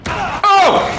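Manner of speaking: loud high startled shout; startled cry. Real, not acted. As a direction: yelp in fright. Jump scare in horror game.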